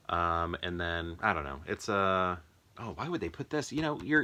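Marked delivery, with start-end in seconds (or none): none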